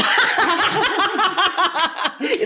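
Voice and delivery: amused laugh at catching her own slip; high laugh. Real, not acted. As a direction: laugh at yourself, pitch jumping up.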